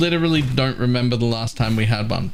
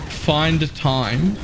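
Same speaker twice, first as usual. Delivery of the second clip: slow deliberate; much slower than usual. Real, not acted. Sarcastic challenge to chat.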